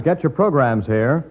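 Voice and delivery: usual speaking voice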